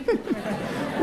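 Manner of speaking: quiet airy chuckle; a soft laugh at own joke. Real, not acted. (tawa kecil yang pelan)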